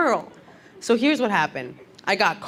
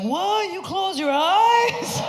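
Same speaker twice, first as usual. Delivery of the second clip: high-pitched, drawn-out mock astonishment; mock amazement; 11 semitones higher, much slower than usual. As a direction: play amazed, stretching the words out high. Mock disbelief at someone's advice.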